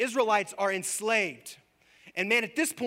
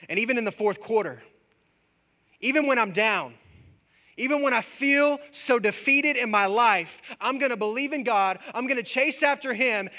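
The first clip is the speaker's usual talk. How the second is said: much louder and higher, fervent climax; full-force preaching; 9 semitones higher, 8 dB louder than usual. Acted. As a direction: drive it home like a revival preacher.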